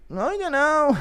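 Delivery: high-pitched mock Irish voice; put-on Irish fighter, way up high; Conor McGregor. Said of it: "Connor answering the question".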